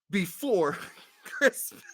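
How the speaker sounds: words broken by laughter; laughing. Real, not acted. Host tries to read the title while cracking up. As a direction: fight through the giggles.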